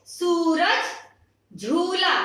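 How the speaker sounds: सूरज, झूला with a long stretched u; drawn-out long vowel. Shown as a demonstration. Teacher explaining long u to children, stretching it deliberately. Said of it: "लंबा खींच के बोलते हैं".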